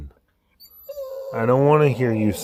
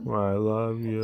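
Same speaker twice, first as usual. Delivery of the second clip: much softer mumbled I love you; mumbling; 7 dB quieter, slower than usual. Pretend. Voicing the dog's mumble again, softer. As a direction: mumble it under the breath. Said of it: "You're mumbling".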